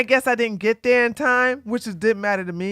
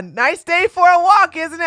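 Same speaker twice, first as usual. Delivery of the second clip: put-on Australian accent; 8 semitones higher, 6 dB louder than usual. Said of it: "like an Australian accent".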